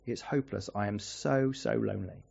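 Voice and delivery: quiet, broken voice of a lonely man; tearful despair. Retold or imitated. Lonely neighbour telling the pastor, tearful and quiet. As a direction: let it crack, hopeless and alone. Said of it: "He broke down in tears".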